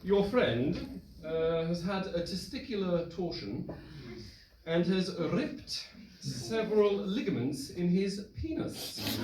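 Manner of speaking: high, slow, stiff upper-class doctor voice; posh British doctor. Retold or imitated. The repressed doctor reading the diagnosis, posh and slow. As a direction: deliver it like an uptight posh doctor. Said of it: "posh ... uptight, repressed British doctor".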